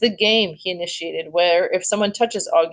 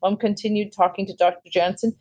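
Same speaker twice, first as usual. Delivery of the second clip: ordinary delivery, nothing marked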